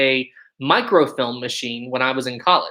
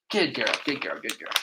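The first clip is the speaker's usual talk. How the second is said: soft praise; 2 semitones lower, 9 dB quieter than usual. Real, not acted. Owner praising Rosie for sitting, soft tender praise. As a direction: praise the dog softly.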